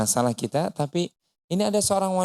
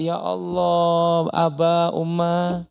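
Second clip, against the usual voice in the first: long drawn-out vowels, fondly acted; delighted greeting; 2 semitones higher, much slower than usual. Wife greeting her parents while hiding the hurt, drawn-out delight. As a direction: stretch each word out in mock happy surprise.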